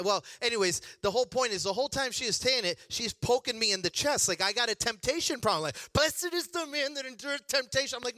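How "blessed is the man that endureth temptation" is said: imitating mom reciting scripture, pitched high; high, preachy mock verse-quoting; mock scripture recital. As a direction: quote the verse at him like a preaching mother. Mimics mom's scripture quoting.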